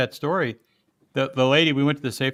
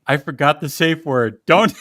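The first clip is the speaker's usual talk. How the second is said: mock panic; 4 semitones higher, 6 dB louder, slower than usual. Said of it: "I'm like".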